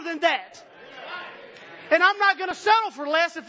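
loud, high preaching to the congregation; full-force preaching. Acted. Preacher to his church, loud and high pitched. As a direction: bring it to the pews at full force.

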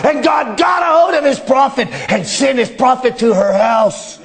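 preacher retelling a miracle to the church, full voice; preaching. Acted. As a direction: drive it home with rising fervour. Preaching the healing story fervently.

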